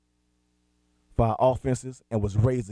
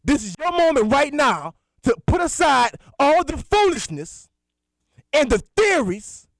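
much higher and louder, fiery preacher; full-force preaching; 10 semitones higher, 7 dB louder than usual. Acted. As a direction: preach it hard to the lost.

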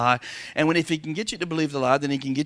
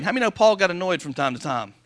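ordinary talk, nothing marked

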